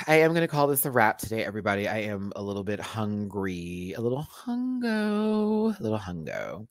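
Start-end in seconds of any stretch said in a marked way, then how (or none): 3.9-5.8 HUNGO high and slowly drawn out; playful high singsong. Real, not acted. Host playfully admitting hunger, high singsong on HUNGO. Playfully restates being hungry. 5.8-6.7 HUNGO softer and dropped low; low, offhand repeat. Real, not acted. Host repeating the hunger joke, low and soft on HUNGO. Same words in lower voice.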